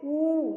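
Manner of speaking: emphatic drawn-out कू; forceful long vowel. Shown as a demonstration. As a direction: hold the oo of कू long. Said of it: "जोर से".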